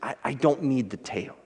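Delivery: faltering, stumbling over the first word; a frightened man losing his nerve, lightly voiced; shaky voice. A narrator's reading. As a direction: customer pleading with the barber, understated shaky voice. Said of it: "said the man in a shaky voice".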